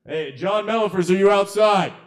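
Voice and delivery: high, loud shout to someone outside; calling out. Real, not acted. Host hailing a missing performer outside, loud high call. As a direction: project it through the door. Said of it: "can people hear me outside?".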